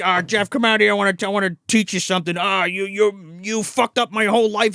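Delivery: put-on domestic argument; acted arguing parent. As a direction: rant at the kid, half in earnest. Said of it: "hears his parents arguing".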